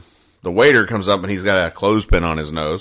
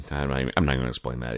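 muttered aside; 8 semitones lower, 10 dB quieter, much faster than usual. Real, not acted.

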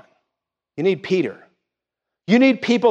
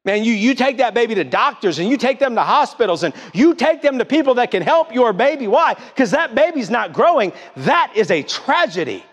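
urgent preaching; 6 semitones higher than usual. Acted. Preacher climbs about baby's growth.

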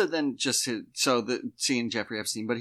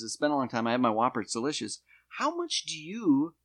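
normal talking voice

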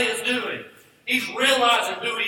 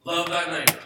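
ordinary talk, nothing marked

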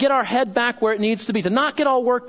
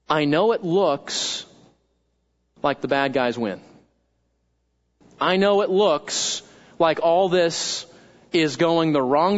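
calm reassurance; 6 semitones lower than usual. Acted. God reassuring believers that the wicked won't win, calm and low. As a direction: speak gravely as God, unhurried.

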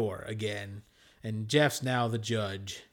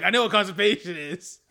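laughing; 7 semitones higher, 6 dB louder than usual. Retold or imitated. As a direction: quote through laughter. Quoting Larry while laughing.

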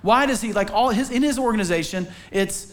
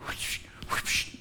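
whooshing sound; 11 dB quieter than usual. Retold or imitated. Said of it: "we're like".

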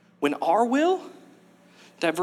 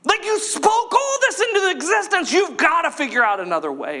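pleading Jesus; 12 semitones higher than usual. Retold or imitated. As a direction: beg God for another way. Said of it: "And he pleads with God".

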